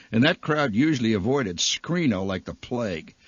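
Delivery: usual speaking voice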